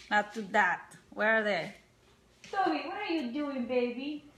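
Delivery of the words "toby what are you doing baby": soft, gentle coo; cooing to a pet. Real, not acted. Owner asks her dog Toby what he's doing, cooing softly. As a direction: talk softly and fondly to the dog.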